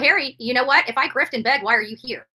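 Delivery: usual speaking voice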